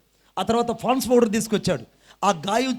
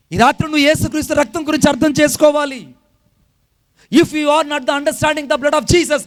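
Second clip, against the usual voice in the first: higher, louder full-force sermon; fiery preaching; 5 semitones higher, 7 dB louder than usual. Acted. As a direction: thunder the warning at the congregation.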